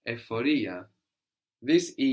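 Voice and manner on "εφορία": "ephoria" said the Greek way with ef first; Greek pronunciation. Shown as a demonstration. Teacher repeating the Greek word for the student, Greek pronunciation. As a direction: pronounce "ephoria" as ef-o-REE-a. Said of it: "This in Greek is".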